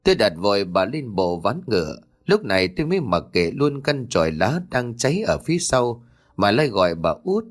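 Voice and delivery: plain reading voice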